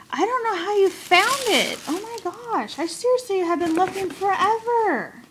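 high-pitched, amazed gushing; amazed excitement. Real, not acted. To a friend who found it, amazed excitement. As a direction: gush in excited disbelief.

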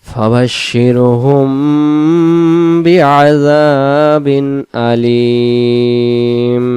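slow melodic tajweed chant, long held vowels; chanted Quranic recitation. Shown as a demonstration. Teacher recites the verse.

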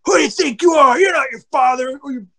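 imitated high-pitched shouting; angry telling-off. Coach scolds a grunting young pitcher, mock angry shout. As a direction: jump down the kid's throat. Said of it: "a coach jumped down his throat".

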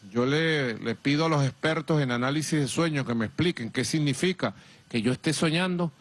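ordinary delivery

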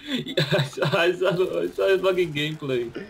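high giggling confession; laughing. Real, not acted. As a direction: laugh through the admission.

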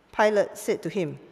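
ordinary talk

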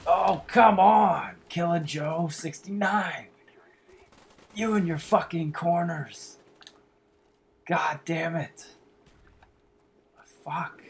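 high, loud, drawn-out angry yell; furious shout. Real, not acted. Gamer screams at Killer Joe and his corners, slow and furious. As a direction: stretch out the rage, slowly.

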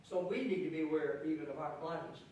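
ordinary talk, nothing marked

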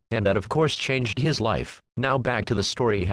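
usual speaking voice

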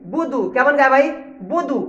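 normal talking voice

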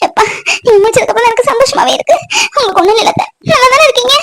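high, tearful pleading; weeping apology; crying. Acted. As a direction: beg forgiveness through sobs. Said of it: "அழாத பவித்ரா, அழாதம்மா".

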